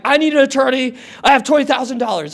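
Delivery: high, hurried retold appeal; urgent request. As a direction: blurt it at the lawyer's desk. Urgent request at law office, retold.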